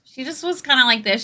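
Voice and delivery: immature, quirky character, pitched slightly up; a hint of a quirky character voice; Alice. Shown as a demonstration. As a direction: lightly voice a quirky, immature girl. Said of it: "She's kinda immature and quirky".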